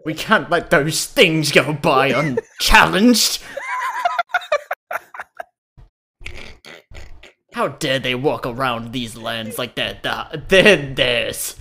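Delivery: loud, slow indignant British colonizer; British colonial accent. Acted. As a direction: rant like a pompous colonial officer. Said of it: "pretty good grasp on the accent".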